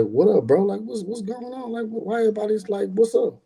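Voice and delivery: puzzled, high-pitched questioning; high, bewildered what's going on; confused. Retold or imitated. Confused brother at funeral.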